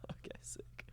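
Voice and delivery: whispered under the breath. Retold or imitated. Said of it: "and you're like".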